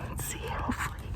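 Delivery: soft sneaking whisper. Real, not acted. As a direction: whisper as you creep. Sneaking up again.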